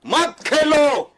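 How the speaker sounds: loud, high, drawn-out angry shout; angry outburst. Real, not acted. Guest shuts down host's case for playing cricket, shouting angrily. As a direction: cut in and shout it down, each word stretched.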